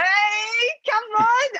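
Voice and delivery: loud, high cry of hype; hyping up her new shirt; excited shout. Real, not acted. Cohost hyping her new Tottenham shirt, excited shout.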